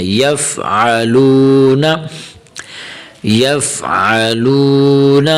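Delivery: slow "یف علون" with a throat ayn in "علون"; clear ayn. Shown as a demonstration. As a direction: squeeze out the ayn of "علون" and hold the long vowel. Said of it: "عین کی آواز نکالنی ہے".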